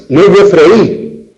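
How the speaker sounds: "No es de freír" slow, stress on "es". Shown as a demonstration. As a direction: lean slowly on "es" in "No es de freír". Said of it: "el acento cae sobre la vocal cerrada". (acento en "es", despacio)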